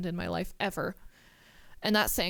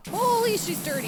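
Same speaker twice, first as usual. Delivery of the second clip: shocked exclaim; 9 semitones higher, 5 dB louder than usual. Real, not acted. Shocked at the dirty truck.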